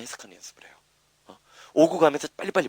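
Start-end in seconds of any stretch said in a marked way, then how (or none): 0.0-1.4 whisper. Real, not acted. Teacher's aside, voice drops.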